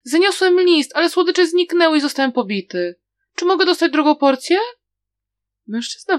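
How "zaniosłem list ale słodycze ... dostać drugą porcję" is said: put-on high, fast tearful child's plea; crying child. A narrator's reading. Beaten child runs to the man and begs for sweets, crying. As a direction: beg through tears like a small child. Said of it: "płacząc".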